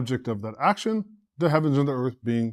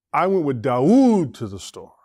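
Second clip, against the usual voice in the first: "Dawood" stretched and raised above the rest; stress on Dawood. Shown as a demonstration. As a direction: punch the name "Dawood" mid-sentence. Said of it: "What do you think is the emphasis on?".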